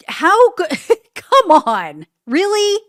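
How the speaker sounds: can't believe what he just read; incredulous. Real, not acted. Reacting to tens of millions in transactions, loud incredulous outburst. Disbelief at the report.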